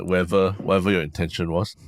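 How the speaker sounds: usual speaking voice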